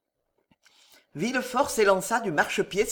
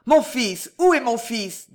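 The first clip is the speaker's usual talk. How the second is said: loud, higher urgent question; anguished demand; 5 semitones higher, 5 dB louder than usual. A narrator's reading. Father asks the servants for his son, anguished and loud. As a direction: demand your child, voice raised in alarm. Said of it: "avec un tel accent d'angoisse".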